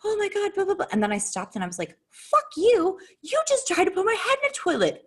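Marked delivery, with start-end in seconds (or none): 0.0-0.9 high-pitched imitation of defensive protest; mock a defensive outburst, pitched way up; play-acted defensiveness, voice jumping high. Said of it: "went on the defense". 2.1-5.0 high, indignant retold outburst; re-enacted fury at a spouse; angry outburst. Retold quarrel, turning on husband.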